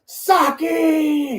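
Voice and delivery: high, drawn-out mock scream. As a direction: imitate an actor screaming from the floor. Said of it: "He's, like, screaming".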